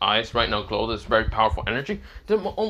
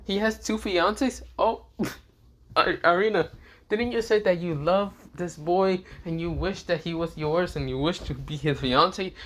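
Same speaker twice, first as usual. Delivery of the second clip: higher voice with a giggle breaking through; giggly teasing in a higher voice; giggling; 6 semitones higher than usual. Acted. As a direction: tease her through giggles. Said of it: "Zenobia kinda just giggles and says".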